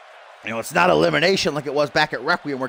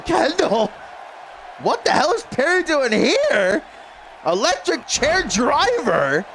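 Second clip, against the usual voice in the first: excited shout; 11 semitones higher, slower than usual. Real, not acted. Commentator shouting at the wild spot, high and slow. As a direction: yell it as the big move lands.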